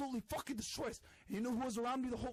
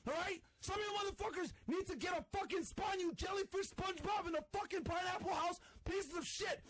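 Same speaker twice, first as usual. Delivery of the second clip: higher, shouted rant; angry shouting; 6 semitones higher, 6 dB louder than usual. Real, not acted. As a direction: yell it like you've lost it. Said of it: "This guy lost his mind".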